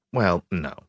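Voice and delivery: ordinary delivery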